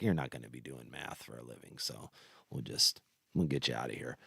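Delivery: lightly voiced kindly teacher, dropped low and quiet. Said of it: "the fucking teacher liked me and was like".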